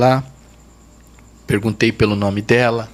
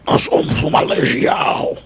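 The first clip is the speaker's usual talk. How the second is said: a rasping, whispered demon voice, pushed out hard; gruff demon voice; 3 semitones higher than usual. Retold or imitated. Pastor retelling the demon's answer, in a harsh rasping demon voice. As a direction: imitate the legion's harsh, breathy growl. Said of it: "Falando aquela voz grossa".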